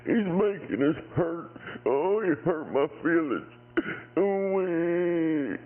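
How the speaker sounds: high, whiny hammed-up crybaby voice; mock crybaby. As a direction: whimper it like an overgrown baby, mocking. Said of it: "You go".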